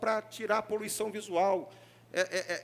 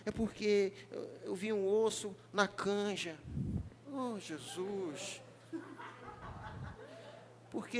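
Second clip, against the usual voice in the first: softer, sulky complaining voice; mock whiny member; 6 dB quieter than usual. Pastor mimicking a member sulking over a bone in the soup, soft whiny voice. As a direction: whine like a hurt churchgoer.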